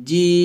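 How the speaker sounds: জিম with the long vowel held steady, lips closing on m; long drawn JIM. Shown as a demonstration. Teacher shows students the four-alif stretch, long drawn জিম. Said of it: "চার লিপ টান দিবেন".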